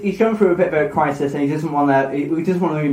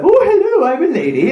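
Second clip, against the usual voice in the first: high-pitched mock lady voice; [name]; 10 semitones higher, 3 dB louder than usual. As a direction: put on a silly, high, ladylike voice. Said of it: "do the voice that you did".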